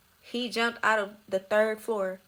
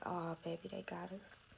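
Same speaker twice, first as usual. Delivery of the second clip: hushed dismay; 12 dB quieter than usual. Retold or imitated. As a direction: say it softly, sinking as it ends. Retold first sight of police.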